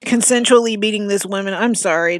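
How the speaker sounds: ordinary talk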